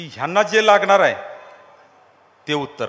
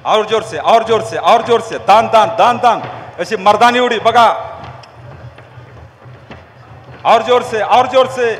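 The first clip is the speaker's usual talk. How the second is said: loud, higher shouted chant; shouted cheering; 3 semitones higher, 7 dB louder, slower than usual. Real, not acted. Speaker urging the jumping crowd to go harder, loud high shout. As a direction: roar them on like a drill leader.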